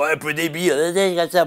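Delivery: mock accent from the French Sud-Ouest; Southwest French accent. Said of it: "un mec avec un accent du Sud-Ouest".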